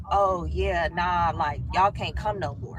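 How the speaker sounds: mock boy voice. The boy telling her she can't come, low offhand mimic. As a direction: drop low and casual like the guy.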